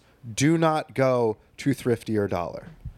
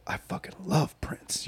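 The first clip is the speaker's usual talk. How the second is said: slow hushed whisper; 7 dB quieter, slower than usual. Retold or imitated. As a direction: breathe it out like a confession. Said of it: "He's like".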